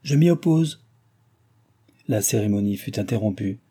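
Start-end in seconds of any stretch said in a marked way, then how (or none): 0.0-0.8 higher-pitched woman's outcry; crying out an objection. A narrator's reading. Woman interrupting a wedding from some distance, crying out. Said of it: "une voix de femme qui s'écria".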